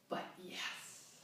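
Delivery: breathy whispered confession. Acted. Leaning close to confess the vibrator in a whisper. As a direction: let it slip out in a whisper. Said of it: "lowering her voice".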